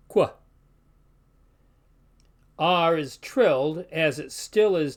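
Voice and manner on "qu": qu as a single "kw"; one QU sound. Shown as a demonstration. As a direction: say qu in one short burst. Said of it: "which just forms one sound".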